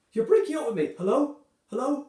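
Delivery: dumped mid-call, loud distressed shouting; hurt outcry. Acted. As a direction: cry out in disbelief, then call into a dead line. Dumped by emoji, line breaking up.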